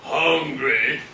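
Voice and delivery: a hint of a booming motivational speaker; Les Brown voice. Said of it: "I'm gonna try and do it in his deep voice".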